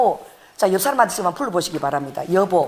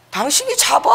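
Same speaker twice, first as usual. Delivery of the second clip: laughing; 7 semitones higher than usual. Retold or imitated. Husband laughing it off.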